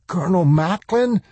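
high, cracking call. Acted. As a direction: let the voice crack in alarm. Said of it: "his voice cracked".

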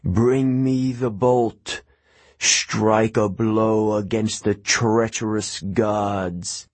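slow, hungry monster voice; hungry ancient voice. A narrator's reading. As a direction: crave it, slow and heavy. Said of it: "The voice became hungrier".

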